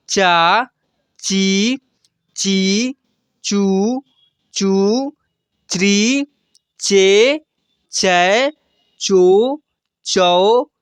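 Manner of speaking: each syllable spoken slowly and separately; barakhadi recital. Shown as a demonstration. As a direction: sound out every syllable clearly for the class. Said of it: "ऐसे उच्चारण करेंगे". (हर अक्षर अलग-अलग साफ़ बोलना)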